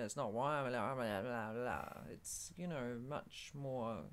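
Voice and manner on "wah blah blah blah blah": broad Aussie gibberish, syllables slurred together; unintelligible broad Australian. Shown as a demonstration. Host showing what hybrid is not, garbled broad Australian. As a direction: imitate incomprehensible broad Australian. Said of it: "you can still kind of understand it".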